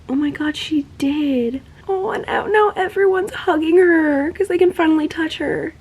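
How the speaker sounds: high-pitched squeal of joy; gush, voice climbing high with emotion; moved gushing. Real, not acted. Gushing over a long-awaited moment.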